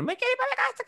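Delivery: put-on very high, whiny child tantrum; berrinche de niño. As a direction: imitate a kid wailing to go home. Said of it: "empezaba a hacer berrinche en el super". (berrinche agudo de niño)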